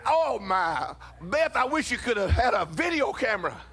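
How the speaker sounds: ordinary delivery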